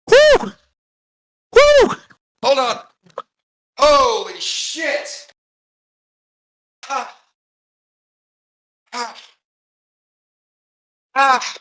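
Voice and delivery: pained yelps through a hamstring cramp; groaning through a sudden leg cramp. Real, not acted. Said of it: "Oh, that is a hamstring cramp".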